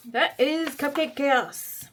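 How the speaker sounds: drawn-out title announcement; slow announcer voice. Acted. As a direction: present the title slowly and grandly. Announcing game name.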